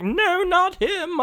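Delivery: high, drawn-out whine of objection; mock protest. Sunfire objects to being paired with Nightcrawler, mock protest.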